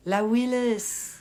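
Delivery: drawn-out American "Willis"; hammed-up American accent on the name. Shown as a demonstration. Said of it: "je dis toujours avec l'accent américain".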